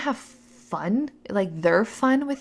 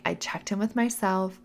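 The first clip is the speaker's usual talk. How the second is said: normal talking voice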